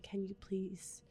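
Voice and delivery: hushed, tightly controlled near-whisper; retold plea through barely held patience, very soft; strained calm. Mom retelling asking her son to read, strained calm. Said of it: "trying to keep my calm".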